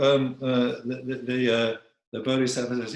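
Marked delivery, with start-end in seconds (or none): none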